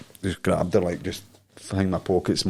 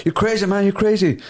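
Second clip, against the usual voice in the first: mock indignant woman; 11 semitones higher, 4 dB louder than usual. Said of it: "I'm like".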